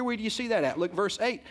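usual speaking voice

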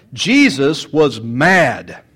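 drawn-out, heavy declaration; slow emphatic. Acted. Preacher insisting to the congregation that Jesus was angry. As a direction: lean on every word slowly. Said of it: "sermon emphasis".

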